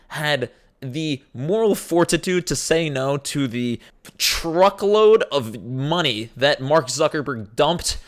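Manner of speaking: slow, deliberate sarcastic drag; slow mocking drawl. Ridiculing the Kylie complaint, slow sarcastic delivery. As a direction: stretch it out with scorn. Drawn-out mock.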